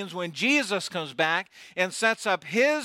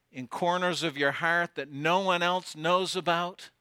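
ordinary talk, nothing marked

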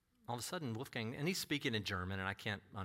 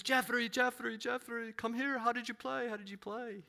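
Wolfgang calling out; 10 semitones higher than usual. Retold or imitated. Wolfgang calling him over.

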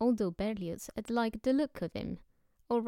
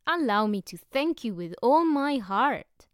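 loud, heartfelt gratitude; effusive thanks; 4 semitones higher, 8 dB louder than usual. A narrator's reading. Stranger shaking the editor's hand, loud hearty thanks. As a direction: pump his hand and thank him loudly.

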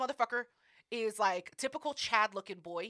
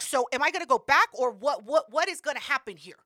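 louder exasperated demand; 3 semitones higher, 8 dB louder than usual. Retold or imitated. Pressing the receptionist for answers, exasperated. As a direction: push them for an answer, loud.

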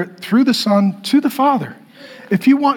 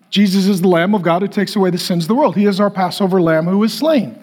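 usual speaking voice

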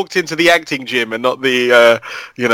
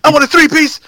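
wrestling promo; 12 semitones higher, 5 dB louder, faster than usual. Acted. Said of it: "I wish I could just talk in wrestling promos".

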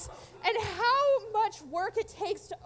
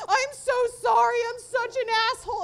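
high, loud mock-panicked plea; hammed-up terror, apologizing loudly; imitated terrified apology; 6 semitones higher, 9 dB louder than usual. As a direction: shout it like a frightened man begging off a cat attack. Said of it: "genuinely terrified".

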